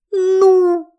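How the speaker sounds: high excited squeal. A narrator's reading. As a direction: squeal with joy. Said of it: "завизжала Ирен".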